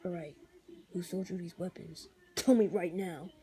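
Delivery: low, quiet, menacing growl; a lightly voiced growling vigilante; Punisher voice. Shown as a demonstration. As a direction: threaten him, deep and cold. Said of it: "The Punisher voice is like".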